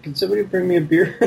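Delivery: high, quick, lazy request; lazy demanding voice. Retold or imitated. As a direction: call out lazily for a beer. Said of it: "you're like".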